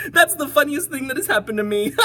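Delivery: giggling through the line; laughing. A narrator's reading. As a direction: speak while giggling. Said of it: "He began to giggle".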